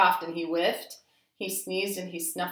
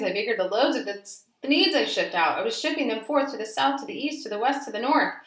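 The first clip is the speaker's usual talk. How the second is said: building excitement; 5 semitones higher, 5 dB louder, faster than usual. A narrator's reading. Growing bigger and faster.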